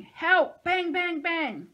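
very loud, high, explosive; yell, then fire off shots by voice; frantic shouting. A narrator's reading. Nico fires as kids scream, frantic shouting.

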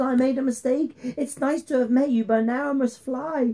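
high, nervous dragon voice; spluttering dragon. A narrator's reading. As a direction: excuse yourself like a cowed dragon. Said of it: "he spluttered".

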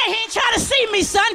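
usual speaking voice

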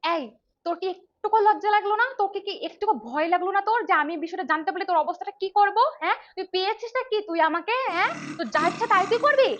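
high, loud scolding yell; furious shouting. Acted. The wife lashing out at her husband, shouting furiously. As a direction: tear into him, screaming. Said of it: "আমি এত জোরে জোরে কথা বলি".

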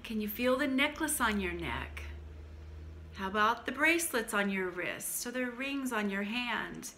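usual speaking voice

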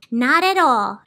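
high, dreamy lady savouring silence; enchanted, lilting high old lady; Soundkeeper voice. Acted.

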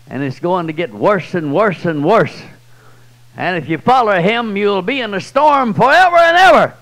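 building to a loud, high preaching cry; rising preaching shout. Real, not acted. Preacher warning his congregation, swelling to a loud shout.

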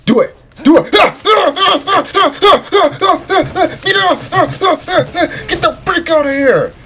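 high, building excited yells; real gamer hype; wildly hyped. Real, not acted. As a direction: urge it on, voice climbing with excitement. Cheering on the big moment.

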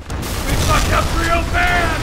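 slow, loud yell of panic; panicked shout. Acted. Lynch shouting to Kane in a panic, loud and slow. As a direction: scream the mistake out.

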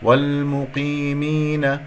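والمقيمين drawn out, lightly heavy qaf; slow recitation of والمقيمين; tajweed demonstration. Shown as a demonstration. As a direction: recite والمقيمين slowly with long ee. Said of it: "قاف مفخمة مرتبة أخيرة".

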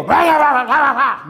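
high, loud blather; a thirty-six-year-old mocked for babbling; mock babble. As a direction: rattle off nonsense like a careless man. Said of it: "every day go".

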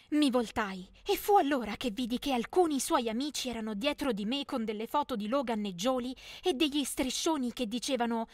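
ordinary delivery, nothing marked

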